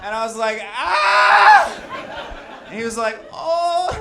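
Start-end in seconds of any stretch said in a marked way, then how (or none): 0.6-2.6 long, louder, higher shriek; answering mock scream. Driver screaming back at the man he hit, piercing mock scream. 3.3-4.0 high, loud "oh" wail; mock alarmed cry. Hit man crying out at the driver, high mock wail.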